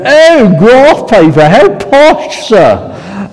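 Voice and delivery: high, loud, mock-astonished "Oh, graph paper, how posh"; mocking kids. Nottingham pupils teasing the southern teacher, high mock surprise. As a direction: imitate schoolkids teasing the teacher's grahf. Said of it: "And they go".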